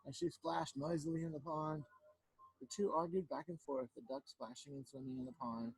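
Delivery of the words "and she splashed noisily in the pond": usual speaking voice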